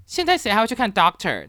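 mock a student talking back to the teacher; retorting tone. Student snaps back at the teacher in class, a mildly imitated retorting tone. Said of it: "怼人的语气".

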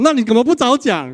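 high, loud why-didn't-you-say; exasperated mother. Retold or imitated. Mother to son who wants his father, impatient. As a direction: scold with mock impatience. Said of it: "然后妈妈就说".

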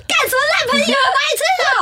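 high, loud outraged scolding breaking into a laugh; indignant friend. Retold or imitated. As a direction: play the friend blowing up at the bad friends.

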